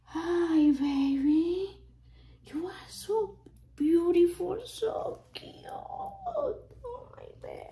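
high, loving coo near tears. Real, not acted. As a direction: coo to a newborn, voice catching. Cooing to doll, near tears.

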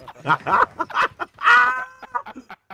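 loud high cackling laugh; long loud laughing fit. Real, not acted.

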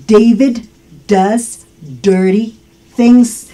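"David does dirty things", one word at a time; English D demo. Shown as a demonstration. Teacher models three D words, "David does dirty" slowly and separately. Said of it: "Ahí utilicé tres palabritas con D".

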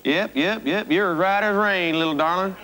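cheery hokey Southern twang; cornball country accent. Acted. Guest confirms his fame in a cornball country accent. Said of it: "you're doing this cornball accent for".

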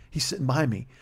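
ordinary delivery

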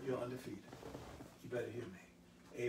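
ordinary talk, nothing marked

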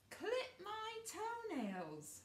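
high, slow, drawn-out demand; bossy brother. Acted. Brothers ordering Cinderfella around, high slow bossy demand. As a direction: whine the order like a spoiled brother.

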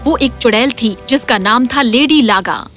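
plain reading voice

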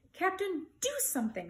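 mild urgent shout, high start. A narrator's reading. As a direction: call out urgently for action. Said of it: "shouted Bartholomew".

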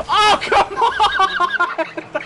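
high, slow complaint breaking into laughter; laughing exasperation. Real, not acted. Streamer laughing at a missed shot, exasperated. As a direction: let the frustration dissolve into a laugh.

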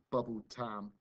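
usual speaking voice

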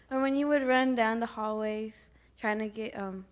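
normal talking voice

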